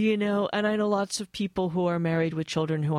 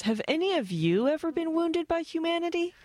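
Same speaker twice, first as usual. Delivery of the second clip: flat American voice; 9 semitones higher than usual. Retold or imitated. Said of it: "in my flat American voice".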